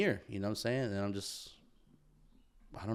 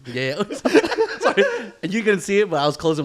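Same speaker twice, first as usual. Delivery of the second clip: high, loud, laughing through the words; breaking up with laughter, much higher and louder; 10 semitones higher, 12 dB louder than usual. Real, not acted. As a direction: giggle while admitting it. Still laughing after prime joke.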